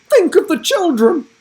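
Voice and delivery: high, loud, pleading; scandalised teacher. Acted. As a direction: cry it out in shock.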